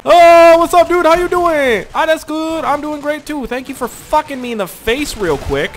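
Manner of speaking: high, loud sarcastic cheer; mock friendly greeting. Gamer sarcastically greeting the enemy who shot him, high fake cheer. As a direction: greet your killer with fake delight.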